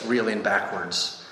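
ordinary delivery, nothing marked

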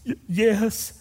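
put-on high little-girl voice with a gasp; mock-sobbing small child's answer; crying little granddaughter. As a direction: imitate a tearful child catching breath. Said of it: "She was crying".

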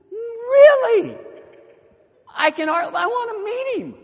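very high, loud, hammed-up enthusiasm; over-eager delight. Pastor hearing the husband is here, exaggerated excitement. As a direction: gush with exaggerated interest. Retold eager reply to wife's news.

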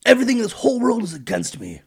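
high, loud melodramatic wail; super dramatic. Retold or imitated. Old father Jacob laments his sons, hammed-up high whine. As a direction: ham up the self-pity of an old father. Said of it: "He got super dramatic and said".

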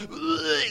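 high whiny ugh; mock groan of disgust. Siblings dreading medicine, high mock groan. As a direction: groan like a kid refusing a pill. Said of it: "They're like".

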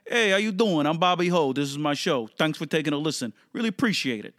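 lively Italian Brooklyn show-host greeting; Italian Brooklyn accent. Shown as a demonstration. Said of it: "stereotypical Italian Brooklyn accent".

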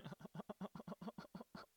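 high rapid giggle; trailing run of quick laughs. Real, not acted. Podcaster tickled by gushing to listeners, giggling.

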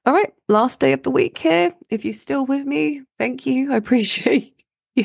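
squeaky, croaky throat. Real, not acted. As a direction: voice cracking into squeaks. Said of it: "squeaky voice".

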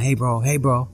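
high-pitched call to grab someone's attention; imitated yell. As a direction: build from "hey bro" into a high, urgent holler. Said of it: "then he yells at me".

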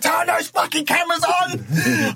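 high, slow, fired-up yell to the crew; triumphant shout. Retold or imitated. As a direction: bellow it across the set like you've won. Director yelling at crew.

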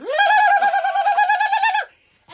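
high loud alarmed shriek; women shrieking. Retold or imitated. Village women flipping out at the man, loud shriek. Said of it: "women around me started flipping out".

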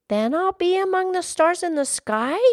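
high, excited rising question; high-pitched child's eager question; lassie crying out. Acted. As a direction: cry it out hopefully as a little girl. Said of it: "cried the lassie".

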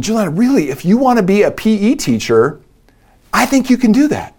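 reassuring dad. Retold or imitated. Dad reassures crying daughter again.

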